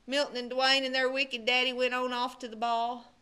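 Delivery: ordinary delivery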